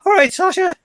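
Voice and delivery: imitating a flustered, excited fan's yelp; freaked-out fan. Record shop worker answering his DJ idol's call, freaked out. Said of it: "That used to freak me out".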